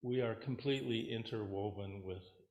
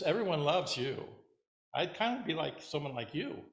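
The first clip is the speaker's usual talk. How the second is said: voicing Mara; 3 semitones higher, 7 dB louder than usual. Mara voiced pleading with Buddha.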